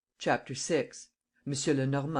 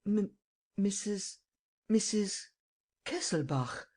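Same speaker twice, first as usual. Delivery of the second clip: broken, halting stammer in shock; 6 semitones higher, slower than usual. A narrator's reading. Old man stammering at seeing the widow, stupefied. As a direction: stumble over the name, stupefied. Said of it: "he stammered".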